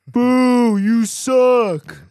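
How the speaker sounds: loud, high playful jeer; jeering at the joke teller, loud and playful; mock heckling boo. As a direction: boo him off the stage for fun.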